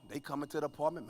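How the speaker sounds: ordinary talk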